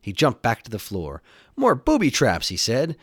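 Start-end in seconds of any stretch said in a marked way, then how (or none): 1.6-2.5 high, louder hero's cry; storybook hero under attack; alarmed exclamation. Acted. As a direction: exclaim it as danger appears. Hero reacts to flying bricks.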